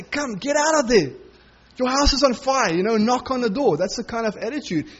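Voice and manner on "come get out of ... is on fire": higher, raised call to get out now; calling a neighbour out of a burning house; urgent warning. Retold or imitated. Preacher urging a neighbour out of his burning house, urgent raised call.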